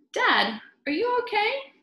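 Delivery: put-on high, fast child's voice; worried child. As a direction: ask like a worried little boy.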